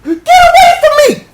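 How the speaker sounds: high, loud shriek; frightened scream. Acted. Woman screaming at her attacker, high and loud.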